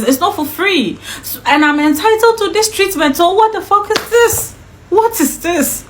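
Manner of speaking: indignant rant, pitch climbing on the questions; raging inner tirade. Retold or imitated. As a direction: retell it as a fuming protest. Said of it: "So I was raging in my heart".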